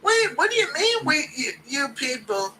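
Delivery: high, mock Ian Gary trying to sound cool; Gary code switching. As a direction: talk high and eager like a try-hard. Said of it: "Ian Gary code switching".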